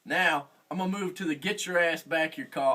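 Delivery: ordinary talk, nothing marked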